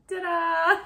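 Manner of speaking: high, loud ta-da flourish; a showman's ta-da. Retold or imitated. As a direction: announce it like a magic trick. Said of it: "it was like".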